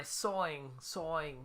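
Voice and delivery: "sawing" for soaring, R dropped, New Jersey style; New Jersey accent. Shown as a demonstration. Musician excusing own word to viewers, New Jersey accent. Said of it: "excuse my New Jersey accent".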